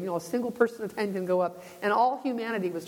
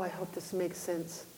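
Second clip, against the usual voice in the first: ordinary talk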